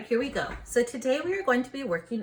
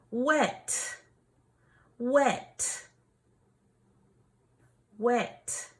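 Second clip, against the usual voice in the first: wet, stretched with a crisp final t; final consonant drill; 5 semitones higher, much slower than usual. Shown as a demonstration. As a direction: say wet slowly and land the t. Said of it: "watch how I do it".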